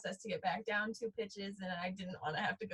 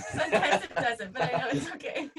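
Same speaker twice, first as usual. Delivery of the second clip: laughing through the words, a bit louder; 5 semitones higher, 6 dB louder than usual. Real, not acted. Wife laughs while answering her husband's joke, laughing. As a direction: giggle it out while you answer.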